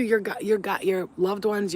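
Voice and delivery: usual speaking voice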